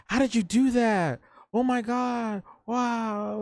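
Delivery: high, loud imitated fangirl gushing; mock awestruck squeal, drawn out; freaking out. Coworkers amazed he fetched the celebrity, high mock freak-out. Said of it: "my coworkers at the time were freaking out".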